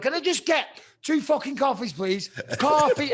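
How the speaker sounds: loud, high exasperated retold plea; wound up. Customer retelling his order at the drive-thru, wound up. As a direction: demand it at the end of your patience. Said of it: "starting to wind myself up".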